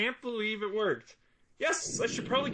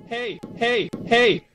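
hey building to a loud final shout; building call; 3 semitones higher, 6 dB louder, much slower than usual. Acted. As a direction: call louder each time, last one a yell. Mosquito calling out, rising.

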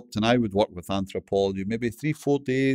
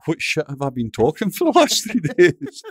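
laughing through it; 7 semitones higher, 7 dB louder than usual. Real, not acted. Laughing at himself.

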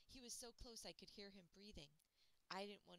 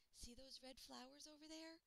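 put-on higher girl voice, pointing something out; lightly voiced girl, curious; Luna's voice; 8 semitones higher than usual. A narrator's reading. Girl shows her friend the red flowers, higher voice. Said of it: "she said".

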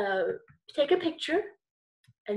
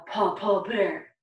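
Papa Bear voice; 5 semitones lower, slower than usual. Shown as a demonstration. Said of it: "That's the voice we wanna use".

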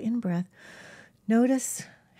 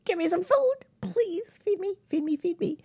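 high pleading pet voice, repeated feed me; hungry pet begging; 8 semitones higher than usual. Pretend. Pet begging its owner for food in a high plea. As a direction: beg like a hungry little animal.

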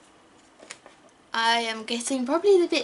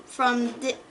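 ordinary talk, nothing marked

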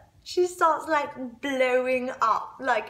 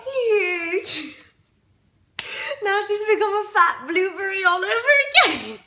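high, quick, giggling gossip; gleeful laughing; 7 semitones higher, 4 dB louder than usual. Acted. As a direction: squeal the news through laughter.

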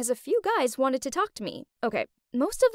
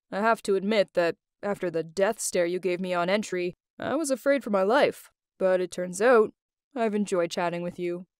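man's voice; 6 semitones lower than usual. Retold or imitated. Old boss Adrian voiced in story.

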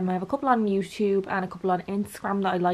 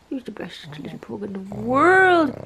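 doting pet talk; 2 semitones higher, 3 dB louder, slower than usual. Real, not acted. Owner tells pug he's the best, doting. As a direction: draw it out adoringly for a little dog.

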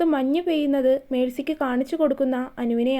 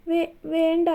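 nervous stammer; 2 semitones higher, slower than usual. A narrator's reading. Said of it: "എന്താണ് ഒരു വിറയൽ".

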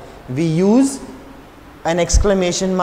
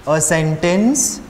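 slow dictation; 3 semitones lower, 5 dB quieter, slower than usual. Shown as a demonstration. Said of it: "you will write the answer".

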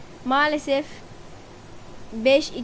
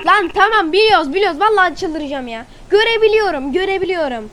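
furious shout, higher and louder; sinirli bağırış; 6 semitones higher, 10 dB louder than usual. Real, not acted. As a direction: yell at the repeating game voice. Oyun aynı şeyi tekrar ediyor. (oyun aynı şeyi söyleyince sinirle bağırıyor)

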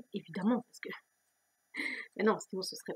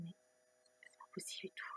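whispered inner thought; 18 dB quieter, faster than usual. Retold or imitated. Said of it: "je me disais".

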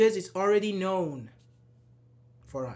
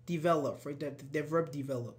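ordinary delivery

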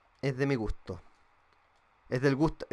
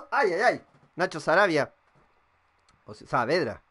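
normal talking voice

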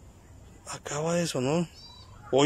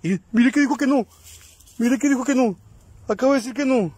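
higher, louder, keyed-up report and questions; thrilled the ghost answered; excited calls; 8 semitones higher, 4 dB louder than usual. Real, not acted. Excited after hearing a reply.

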